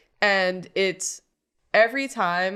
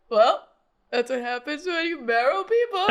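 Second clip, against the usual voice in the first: put-on voice; 9 semitones higher than usual. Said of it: "You go".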